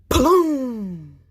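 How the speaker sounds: sudden loud boom; loud gunshot bang. Acted. Man's big gun firing at he lion, loud bang. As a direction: blast it out like a gun.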